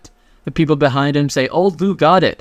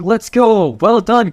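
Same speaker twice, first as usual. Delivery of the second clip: excited cheer; 7 semitones higher than usual. Retold or imitated. Principal cheering for his student, mock excited shout. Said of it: "Principal Lu Yun literally shouts out".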